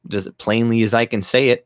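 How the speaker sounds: ordinary delivery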